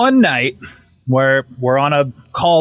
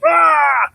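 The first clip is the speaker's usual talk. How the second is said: high mock wail; imitated bawling; 11 semitones higher than usual. Wife bursting into tears, imitated bawling. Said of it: "she starts just bawling".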